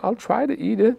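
put-on squeaky high nervous kid voice; guilty kid squeaking; voice cracking up high. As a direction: pitch it way up like a scared boy. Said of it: "my voice went up, like, three octaves".

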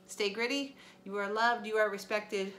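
ordinary delivery

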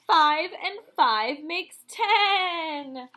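slow, loud, delighted announcement; excited reveal. Acted. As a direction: draw it out and cheer the answer. Teacher reveals answer to children.

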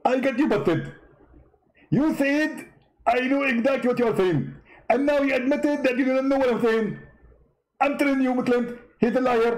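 high-pitched smug debater; high mock Zakir Naik voice. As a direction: pitch way up, gloating. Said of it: "how you talk like that".

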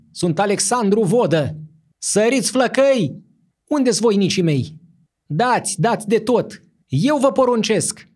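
very high, loud calls to his men; frantic commanding shout. A narrator's reading. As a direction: yell orders in a delirious rage. Ruler calls his soldiers.

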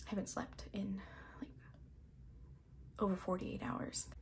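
tired whisper; whispered aside. Real, not acted. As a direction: drop to a whisper as if confessing. Confessing no sleep.

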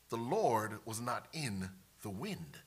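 slow, low and soft; slow hushed scripture line with weight; hushed reveal. A narrator's reading. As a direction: let it drop, quiet and deliberate. Key line of scripture read hushed.

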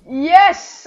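excited yell. Real, not acted. Rocket hit lands on enemy.

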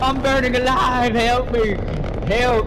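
high mock cry for help; burning scream. Game character on fire, playful panic.

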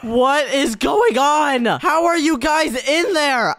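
high, drawn-out disbelief; bewildered outcry. Acted. Calling to swimmers in the strange water, bewildered outcry. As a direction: stretch the words out in shock.

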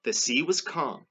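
deliberate storybook narration, starting loud; read-aloud to children. A narrator's reading. Reader closing the story for children, storybook narration. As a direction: read out the ending in measured phrases.

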